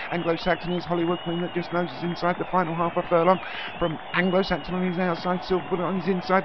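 high, loud, breathless-paced climax; excited race commentary. Real, not acted. Commentator calling a close finish, at full excitement. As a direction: ride one high pitch, climbing to the winning post.

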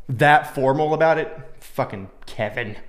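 ordinary talk

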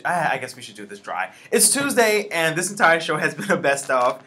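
ordinary talk

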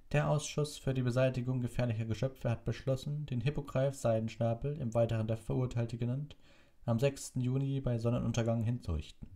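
high-pitched official reading a decree; official proclamation. Acted. Committee official reading out the execution order, high and formal. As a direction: read it out formally, pitched well up.

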